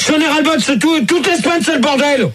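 high-pitched exasperated complaint; worked-up, fed up. Real, not acted. Host fed up with a week of chaos, exasperated. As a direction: complain heatedly, pitch well up. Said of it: "c'est toi qui es énervé ce soir".

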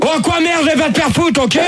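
high-pitched irate swearing; angry outburst. Real, not acted. Host tells off a caller who swore, angry outburst. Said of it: "[name], doucement quand même".

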